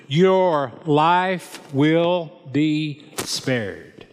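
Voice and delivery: very slow, deliberate pronouncement; solemn vow. A narrator's reading. David turning to Shimei to spare him, solemn vow. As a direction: weigh out every word as a solemn promise. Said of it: "David vowed".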